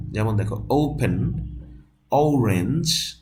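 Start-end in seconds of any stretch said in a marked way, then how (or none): 0.7-1.3 "ওপেন" with an opening ou glide; "ওপেন" said as "ou-pen"; model pronunciation. Shown as a demonstration. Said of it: "এটা হলো ঔ". 2.1-3.2 slow "অরেঞ্জ" with ou glide; higher, rising "অরেঞ্জ" as "ou-rens"; model pronunciation. Shown as a demonstration. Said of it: "এটা হলো ঔ".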